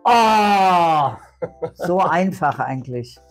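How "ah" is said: excited shout. Real, not acted. Finally gets the word.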